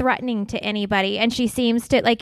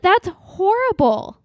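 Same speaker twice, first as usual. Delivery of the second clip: shocked; 9 semitones higher, slower than usual. Real, not acted. Reacting in shock.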